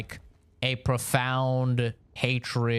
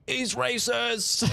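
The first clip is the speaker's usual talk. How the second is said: mocking offended critics; 10 semitones higher, slower than usual. Mocking people on the left, much higher whiny mock-outrage voice. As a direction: ham up a prissy outraged critic, high. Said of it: "people on the left were like".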